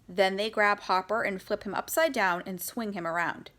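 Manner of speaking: usual speaking voice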